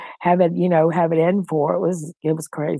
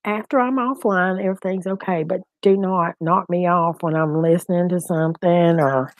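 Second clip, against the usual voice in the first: ordinary talk, nothing marked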